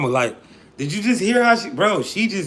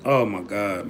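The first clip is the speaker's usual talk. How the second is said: ordinary talk, nothing marked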